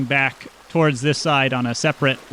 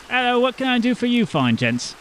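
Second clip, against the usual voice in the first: a touch higher, kindly village teacher; lightly raised teacher voice; lightly voiced teacher; 8 semitones higher than usual. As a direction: greet the boy like a friendly teacher. Teacher greets.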